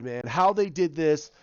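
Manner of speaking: usual speaking voice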